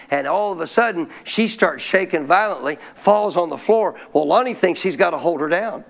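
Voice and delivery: ordinary talk, nothing marked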